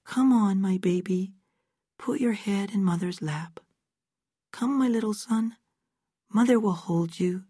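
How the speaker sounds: lightly mock-maternal, coaxing a baby; soft mother tone. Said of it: "She put a soft tone in her voice".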